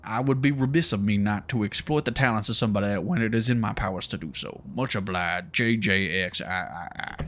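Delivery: put-on southern drawl for a pompous mayor. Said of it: "like a southern drawl".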